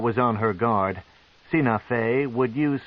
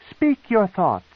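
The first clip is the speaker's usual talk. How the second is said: high, imperious command; 10 semitones higher, 5 dB louder than usual. Acted. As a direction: order him to speak. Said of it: "she commanded".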